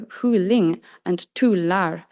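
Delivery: "Hyling and Tular" slowly, each with accent two; careful Swedish "Hyling and Tular", slow double-peaked melody; Swedish pitch accent. Shown as a demonstration. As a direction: give "Hyling" and "Tular" a second pitch peak on the last syllable. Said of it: "demonstrate the pronunciation of these words".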